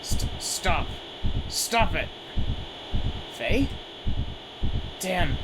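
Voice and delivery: shouting in alarm as the monster breaks loose; panicked warning. Acted. Monster attacks, warns friend to flee.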